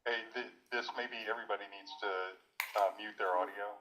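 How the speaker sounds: faint distant echo; audio echo. Real, not acted. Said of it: "Can you hear the echo?".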